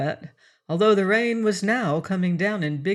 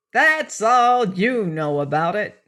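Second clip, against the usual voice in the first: slow, high, peevish little man's voice; a small fussy man grumbling; 4 semitones higher, 5 dB louder than usual. Acted. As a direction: complain like a petty little grump.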